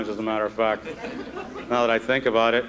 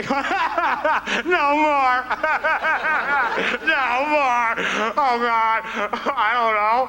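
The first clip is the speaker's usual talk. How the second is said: overwhelmed Adam; 6 semitones higher than usual. Pretend. Adam overwhelmed naming animals.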